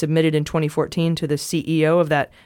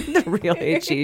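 laughing hype; 11 semitones higher than usual. Pretend. Hip hop title joke.

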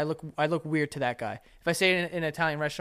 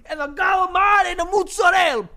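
loud, high exaggerated Italian-American accent; mock Italian accent; 7 dB louder than usual. Mocking a gabagool restaurant order, loud mock Italian accent. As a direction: belt out the order like a caricature Italian. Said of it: "I'm not gonna do that".

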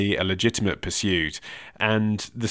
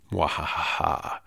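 evil cackle; 5 semitones lower, 6 dB quieter than usual. Pretend. Gloating that his plan works.

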